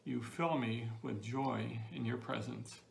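normal talking voice

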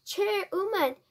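high-pitched, incredulous exclamation; shocked cry. A narrator's reading. Father defends the accused woman's title, high shocked cry. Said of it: "cried Mister Jenkins".

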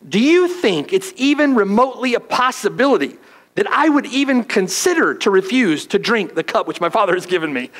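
high, insistent paraphrase of a firm refusal; emphatic. Retold or imitated. Pastor paraphrasing Jesus to Peter, emphatic refusal. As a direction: make it unthinkable, push every word. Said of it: "It's, it's an emphatic, an emphatic answer".

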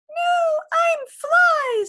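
very high, loud buzzy little fly; tiny fly voice. Acted. Fly answers the kitten, very high and tiny.

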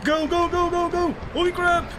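high, loud urgent shouts; panicked yelling. Real, not acted. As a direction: scream at yourself to run. Chased by monsters, yelling.

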